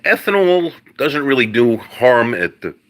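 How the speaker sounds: real dry-throat strain mid-sentence; choking up. Real, not acted. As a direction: push the words out while choking up. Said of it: "I'm choking up a little bit".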